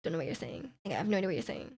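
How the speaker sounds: bewildered half-swallowed grumble; baffled mumble. Retold or imitated. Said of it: "I'm like".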